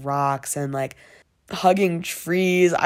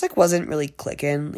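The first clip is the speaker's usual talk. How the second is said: usual speaking voice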